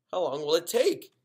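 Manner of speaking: put-on girl whining to her dad; whiny. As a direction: whine it like a bored kid. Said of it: "asked in a whiny voice".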